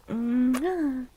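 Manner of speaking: ordinary talk, nothing marked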